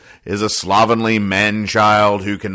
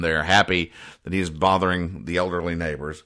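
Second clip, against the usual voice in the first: ordinary delivery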